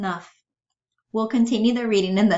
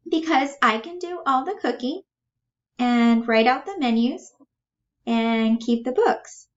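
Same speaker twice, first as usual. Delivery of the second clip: higher voice with a touch of nerves; mildly nervous, high-pitched eagerness; nervous Bella; 7 semitones higher, 3 dB louder than usual. Acted. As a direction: explain it high, halting between phrases. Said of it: "Starting to get nervous".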